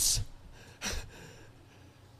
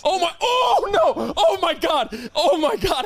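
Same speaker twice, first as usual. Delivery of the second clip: laughing exclamation; 10 semitones higher than usual. Real, not acted. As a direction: cry out through laughter. Laughing at the image.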